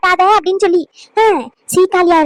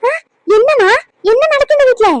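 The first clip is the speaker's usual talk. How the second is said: indignant outcry; 6 semitones higher than usual. Acted. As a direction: demand an answer in outrage. Neighbour confronting the household.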